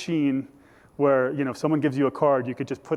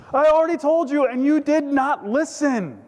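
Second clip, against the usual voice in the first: exasperated shout; 11 semitones higher, 5 dB louder than usual. Acted. Healed man repeating himself to Pharisees, exasperated and loud. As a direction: throw it back loudly, slowing at the end. Acts out blind man's exasperated answer.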